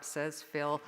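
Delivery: plain reading voice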